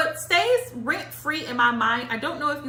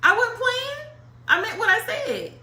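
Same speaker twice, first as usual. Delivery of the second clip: higher, insistent denial; 10 semitones higher than usual. Real, not acted. Telling him she wasn't playing, a high insistent protest. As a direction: insist you meant it, pitched up.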